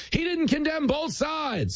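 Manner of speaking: high, drawn-out mock outcry; hammed-up high-pitched outrage; mock accusers. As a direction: parody a tired accusation, slowly. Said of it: "Remember the old".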